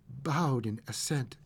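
plain reading voice

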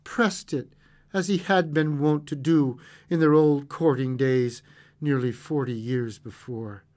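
plain reading voice

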